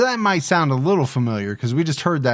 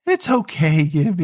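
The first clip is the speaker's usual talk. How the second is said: puppy dog voice; 3 semitones higher than usual. Retold or imitated. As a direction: coo it like to a puppy. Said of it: "the puppy dog voice".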